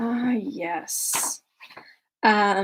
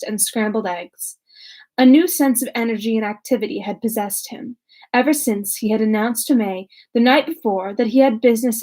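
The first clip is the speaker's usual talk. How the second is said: steady, even audiobook read; calm literary narration; story narration; 8 dB louder than usual. A narrator's reading. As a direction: read the chapter clearly to listeners. Reads novel chapter aloud.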